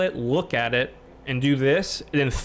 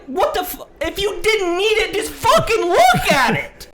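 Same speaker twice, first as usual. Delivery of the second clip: very high, loud and slowed, exasperated; retold outburst; 7 dB louder than usual. Quoting himself yelling at the clerk, shrill rage. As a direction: shriek it out in disbelief. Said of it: "I was like".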